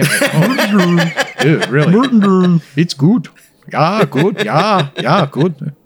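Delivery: high, laughing Muppet-chef voice; puppet voice. Retold or imitated. Host mocks the tasting in a high, laughing puppet voice. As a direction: keep the high puppet gibberish going.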